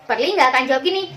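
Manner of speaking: usual speaking voice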